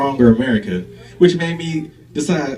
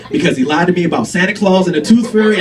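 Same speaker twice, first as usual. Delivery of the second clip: usual speaking voice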